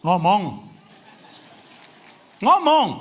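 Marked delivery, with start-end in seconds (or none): 2.4-2.9 louder call. Real, not acted. Preacher urging couples to speak, loud call.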